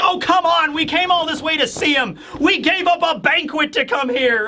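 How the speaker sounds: high, exasperated mock complaint; high-pitched acted griping; whiny protest. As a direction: protest like a let-down kid. Luffy complaining about wasted trip.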